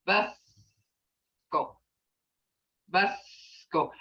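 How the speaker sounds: Busco said low with a faint C; Busco demo. Shown as a demonstration. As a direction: let the C just barely sound in Busco. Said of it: "you'll hear that".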